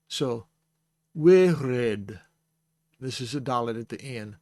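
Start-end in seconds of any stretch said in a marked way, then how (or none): 1.2-2.2 wirid with the R rasped at the back of the throat; guttural resh. Shown as a demonstration. Teacher demonstrating a throaty Hebrew R in wirid. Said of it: "Notice when I say the, the resh".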